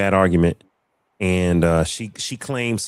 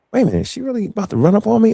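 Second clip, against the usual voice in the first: disbelief; 12 semitones higher, 4 dB louder, faster than usual. Retold or imitated. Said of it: "and I'm looking like".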